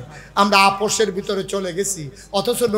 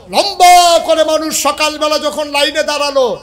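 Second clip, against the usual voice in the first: high, driving pulpit narration; loud, animated sermon storytelling; full-force preaching; 8 semitones higher, 8 dB louder than usual. Real, not acted. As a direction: belt the story out to the congregation.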